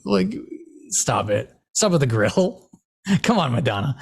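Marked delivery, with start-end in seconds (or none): none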